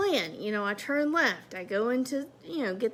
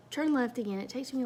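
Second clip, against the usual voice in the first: normal talking voice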